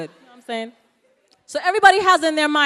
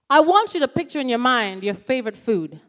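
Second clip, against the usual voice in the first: usual speaking voice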